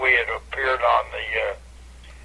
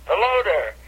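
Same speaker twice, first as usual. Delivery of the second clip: fans greeting; 7 semitones higher than usual. Retold or imitated. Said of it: "they said".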